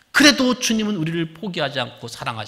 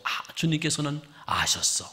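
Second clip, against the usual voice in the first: slow hushed whisper of realization; 3 semitones lower, 5 dB quieter than usual. Acted. Peter realizing the Lord knew, whispered. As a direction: breathe it out as if Peter just understood.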